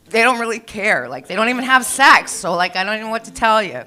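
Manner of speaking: mock-exasperated explanation to clients; loud comic rant. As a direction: blurt it out to the room.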